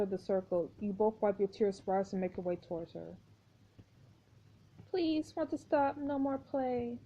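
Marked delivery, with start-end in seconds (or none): 4.9-7.0 put-on high, slow, soft little girl; pleading child voice. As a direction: beg softly like a sad child. [name] begging softly.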